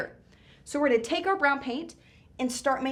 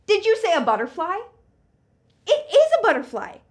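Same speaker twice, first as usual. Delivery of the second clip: big, bright, higher and louder reveal; excited reveal; 8 semitones higher, 9 dB louder than usual. Acted. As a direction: cheer out the answer. Revealing answer to kids.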